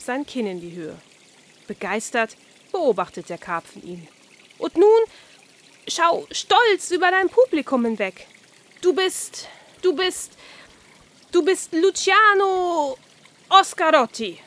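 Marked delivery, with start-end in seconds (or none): 4.6-14.4 much higher, louder, theatrical fish; the carp crowning Oskar a famous tenor; grand carp voice. Acted. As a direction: announce him like a star.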